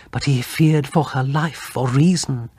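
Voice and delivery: usual speaking voice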